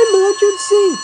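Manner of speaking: very high, loud alarm shout. A narrator's reading. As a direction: yell the alarm with full force. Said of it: "he shouted".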